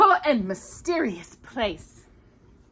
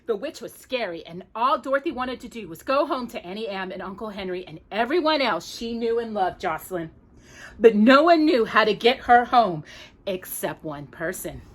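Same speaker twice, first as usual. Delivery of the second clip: ordinary delivery, nothing marked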